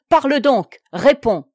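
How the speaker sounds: loud furious command; angry baron shouting at the maid. A narrator's reading. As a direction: bark the order at the kneeling maid. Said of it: "que la colère gagnait".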